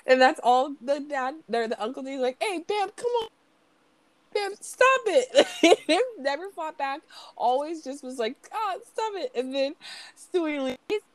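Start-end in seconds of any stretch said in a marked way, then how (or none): 2.4-5.5 helpless pleading uncle. Retold or imitated. Imitating Bam's uncle being messed with. 8.5-9.3 high, softer mock whine; helpless protest. Imitating uncle who never fought back.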